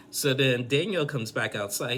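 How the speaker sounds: ordinary talk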